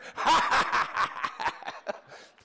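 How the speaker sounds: high, loud unvoiced laugh; breathy excited laugh. [name] laughs after shouting.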